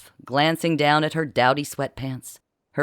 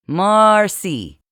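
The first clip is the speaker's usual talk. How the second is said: "Marcy" said louder, each syllable pressed out; "Marcy" enunciated again, louder and insistent; enunciating; 4 semitones higher, 7 dB louder than usual. A narrator's reading. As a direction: spell out "Marcy" firmly, a bit exasperated. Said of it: "enunciating repeatedly".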